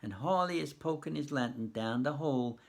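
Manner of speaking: ordinary delivery, nothing marked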